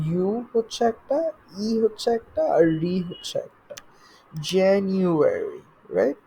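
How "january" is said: "January" slowly as "Jan-you-e-ry"; four syllables. Shown as a demonstration. Teacher puts the four syllables together, "January" slow and careful. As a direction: draw out each syllable of "January". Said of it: "চারটা syllables আছে".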